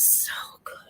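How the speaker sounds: breathy whisper of delight. Real, not acted. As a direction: whisper it like a secret pleasure. Whispered food rapture.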